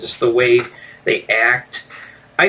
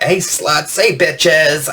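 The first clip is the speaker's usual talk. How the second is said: lightly voiced party girl greeting; mock an obnoxious party girl; a hint of overprivileged party girl; 4 semitones higher, much slower than usual. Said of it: "party girl voice".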